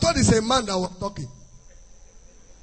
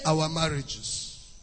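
soft trailing lament; 6 semitones lower, 9 dB quieter, much slower than usual. Real, not acted. Preacher lamenting delayed marriages.

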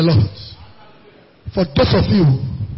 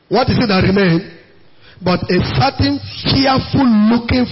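scripture reading; 3 semitones lower than usual. Acted.

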